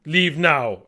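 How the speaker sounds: loud, forceful command; short, shouted demand; big guy's order. Acted. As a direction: order them out at once. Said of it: "I think he just says".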